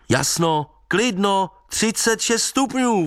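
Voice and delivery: high-pitched call into the distance; calling after him. A narrator's reading. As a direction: call it out high, drawing out the end. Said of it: "volal jsem za ním".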